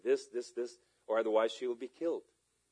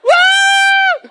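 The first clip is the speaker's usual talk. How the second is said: very high, sustained imitated scream; long high shriek; loud scream; 20 dB louder than usual. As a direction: let out her long, piercing scream. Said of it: "she screamed with a loud voice".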